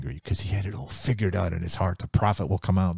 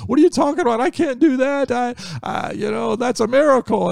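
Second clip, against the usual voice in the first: panicked king, high and loud; freaked-out king; 6 dB louder than usual. Acted. Preacher voicing the panicking king at Naaman: high frantic shout. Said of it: "the king freaks out even more".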